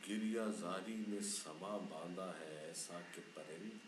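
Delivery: slow, soft ghazal reading; poem recitation. Acted. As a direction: recite it slowly and softly. Poet recites final couplet.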